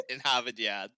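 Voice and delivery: "Harvard Yard" said as "Havad Yad", no R's, through a laugh; Boston accent. Retold or imitated. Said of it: "Just take out all the R's".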